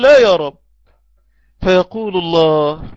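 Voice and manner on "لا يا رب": pleading cry. Retold or imitated.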